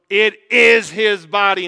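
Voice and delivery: loud, slow, firm declaration; Luther declaring. Retold or imitated. Luther declaring to Zwingli, loud and slow. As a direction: insist on every word like Luther. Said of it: "And Luther goes".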